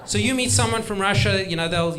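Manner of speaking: ordinary talk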